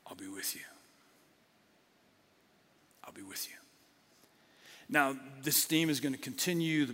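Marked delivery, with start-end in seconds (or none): 0.1-3.6 soft, hushed whisper of a promise; God's whisper. Acted. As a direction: breathe it like a close reassurance. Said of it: "And this is what God says".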